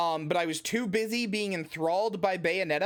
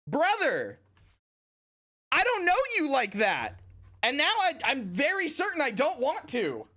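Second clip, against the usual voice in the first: exasperated; 8 semitones higher than usual. Real, not acted. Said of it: "rising exasperated retort".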